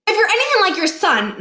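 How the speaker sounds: butter him up; flattering kid. A narrator's reading. Boy flattering the new principal, high and sweet.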